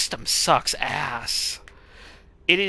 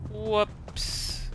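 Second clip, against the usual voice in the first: softer high whoops; oops; 7 semitones higher, 7 dB quieter than usual. Real, not acted.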